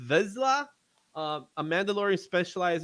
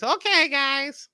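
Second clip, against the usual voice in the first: put-on slow, high little old lady voice; a hint of a sweet grandma; 8 semitones higher, much slower than usual. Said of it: "[name] needs to do the whole show in grandma voice".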